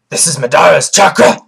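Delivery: hammed-up alarmed fox, opening on a gasp; panicked fox. Vlogger playing the fox sensing Madara's chakra, mock alarm. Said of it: "And the fox is like".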